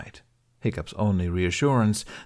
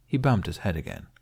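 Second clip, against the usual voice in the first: ordinary delivery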